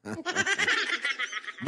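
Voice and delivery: a soft, high chuckle; quiet amused laugh. Real, not acted. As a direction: laugh under the breath. Laughs at own insinuation.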